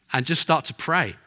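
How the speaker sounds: usual speaking voice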